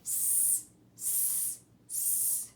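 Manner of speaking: S as a long voiceless hiss sss; snake hiss. Shown as a demonstration. Teacher making Sammy Snake's hissing s sound. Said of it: "What does he say?".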